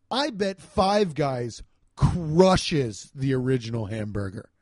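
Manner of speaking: ordinary delivery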